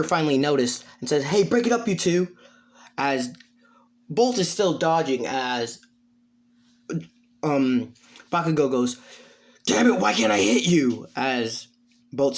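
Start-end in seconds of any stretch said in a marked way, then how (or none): 1.2-2.3 lightly acted higher-pitched teacher; a touch of urgency, raised pitch; teacher calling out. Teacher breaks up a fight. 9.7-11.1 high-pitched, frustrated outburst; exasperated fighter losing patience; Bakugo. Acted. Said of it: "Bakugo is getting angrier and angrier".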